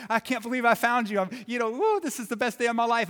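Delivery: put-on a kid hugging his lost boat; gleeful shout of disbelief; overjoyed. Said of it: "he couldn't believe it".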